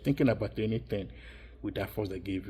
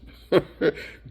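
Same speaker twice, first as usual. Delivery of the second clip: short laugh; 8 semitones higher, 8 dB louder than usual. Real, not acted. Mocking those who speak nasally.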